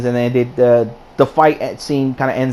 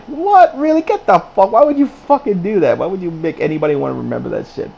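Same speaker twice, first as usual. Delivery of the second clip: exasperated rant; 7 semitones higher than usual. Retold or imitated. Reviewer ranting at the screen over the heat vision, outraged disbelief. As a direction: blurt it out like yelling at the TV. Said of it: "I was like".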